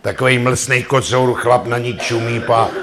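ordinary talk, nothing marked

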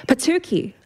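high, startled little outburst; involuntary exclamation. A narrator's reading. Lahi shoved into the wheelie bins, involuntary exclamation. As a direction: let it slip out high, falling away. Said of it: "Lahi exclaimed involuntarily".

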